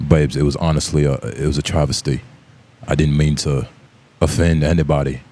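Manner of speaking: low British apology; Jason Statham accent. Acted. Jason Statham accent in a mock apology. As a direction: apologize like a British action hero. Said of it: "apologize to Jason Statham though".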